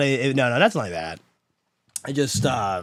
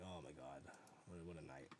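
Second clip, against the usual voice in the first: very soft, low mumbled quote; morning-after regret; 9 semitones lower, 33 dB quieter than usual. Retold or imitated. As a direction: mumble it like waking up hungover. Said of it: "it's always like".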